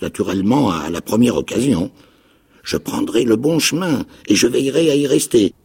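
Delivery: a wild islander, half mad and eager; odd castaway. A narrator's reading. Ben Gunn voice.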